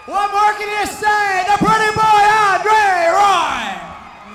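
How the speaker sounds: excited hype. Real, not acted. Interviewer hypes the winner.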